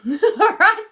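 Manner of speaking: giggling at her own slip; laughing. Real, not acted. Tarot reader laughing at her own Irish greeting.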